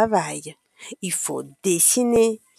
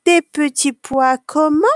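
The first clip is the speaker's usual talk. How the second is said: higher, pointed question with rising end; prompting question; 6 semitones higher, 4 dB louder than usual. A narrator's reading. Canteen lady prompting a child for manners, pointed question. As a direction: fish for the missing polite word. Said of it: "demande une dame qui s'appelle Caroline".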